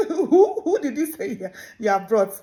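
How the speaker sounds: high question through laughter; laughing disbelief. Acted.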